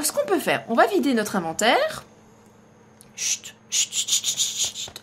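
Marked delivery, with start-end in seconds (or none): 3.2-5.0 chut soufflés sans voix; chuchote en se cachant. Real, not acted. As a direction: fait taire tout bas. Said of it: "on se tait". (chuchotement cachée)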